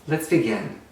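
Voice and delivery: ordinary delivery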